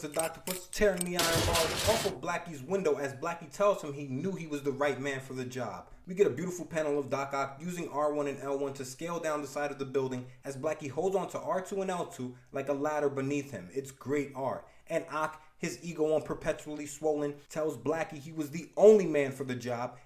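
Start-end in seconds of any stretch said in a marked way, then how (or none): none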